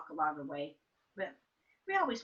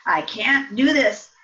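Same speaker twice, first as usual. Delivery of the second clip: tearful protest; 4 semitones higher, 14 dB louder, slower than usual. Retold or imitated. Crying child refuses school.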